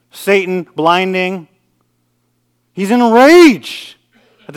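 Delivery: thundering pulpit delivery; impassioned preaching. Acted. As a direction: preach it loud and high, leaning on key words. Preaches at full force.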